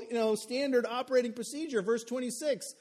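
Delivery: ordinary talk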